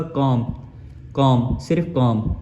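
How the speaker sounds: कॉम ending on m, no b sound; silent b. Shown as a demonstration. Teacher showing students the correct word, silent b. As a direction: say कॉम, lips closing on the final m. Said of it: "हमारा उच्चार क्या हो जाएगा".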